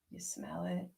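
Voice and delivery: normal talking voice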